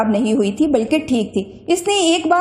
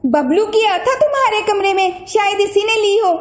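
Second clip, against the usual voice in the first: put-on higher, worried child voices; children's voices; 7 semitones higher than usual. A narrator's reading. Bina and Saif search for the lost watch, worried kids. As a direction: sound like anxious kids.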